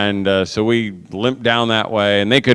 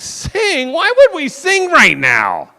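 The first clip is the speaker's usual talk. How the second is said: much higher, slow disbelieving question; retorting in disbelief to his son, much higher; incredulous; slower than usual. Retold or imitated. Father answering his son in disbelief, much higher. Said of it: "And I'm like".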